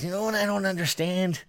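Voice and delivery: put-on older bar regular. Older guy questioning the prime time game, lightly imitated old regular. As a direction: imitate a puzzled older customer. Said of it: "this older guy that comes in".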